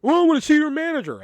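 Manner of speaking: high, loud indignant demand; demanding customer. Retold or imitated. Customer demanding the manager from the cashier, high loud huff. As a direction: insist on the manager, fuming.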